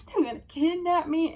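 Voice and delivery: put-on high, loud terrified child; panicked scream. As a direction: scream it like a scared kid.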